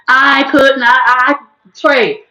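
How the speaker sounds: normal talking voice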